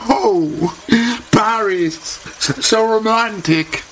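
very high, slow, dreamy swoon; starry-eyed gushing. Acted. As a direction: swoon over romantic Paris. Holly rêve de Paris. (pâmoison très aiguë et lente)

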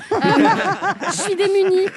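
laughing through the words. Real, not acted. Laughs while confessing.

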